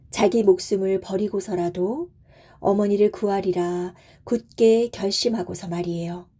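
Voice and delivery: plain reading voice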